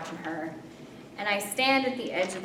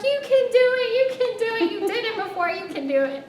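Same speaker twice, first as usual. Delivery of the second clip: high, loud cheerleading, easing down at the end; imitated cheering on; 14 semitones higher, 7 dB louder than usual. Laughing friend urges her back onto the paddleboard, mock high cheering. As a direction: cheer like an excited friend, pitched way up.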